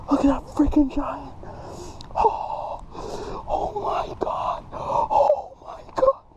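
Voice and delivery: high exclamations breaking into breathy gasps; overwhelmed excitement. Real, not acted. Angler seeing a giant largemouth, overwhelmed excitement. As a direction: marvel at it, voice giving out.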